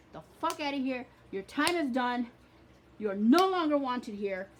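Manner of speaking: loud furious commands to leave; shouting an unwanted presence out the door; angry banishing. Shown as a demonstration. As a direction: throw them out of your life with full anger. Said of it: "anger, with feeling".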